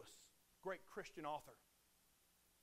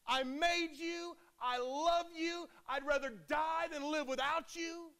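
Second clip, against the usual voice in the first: high, slow, loud and aching, I love you; pleading voice of God; 8 semitones higher, 6 dB louder, slower than usual. Acted. Preacher voicing Jesus to the congregation, passionate loud plea.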